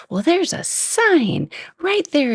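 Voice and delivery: slow, very high-pitched scolding; mock yelling. Winery woman pointing drivers to the sign, mock yelling. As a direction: imitate an exasperated telling-off, stretched and high. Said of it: "she just kept yelling and yelling".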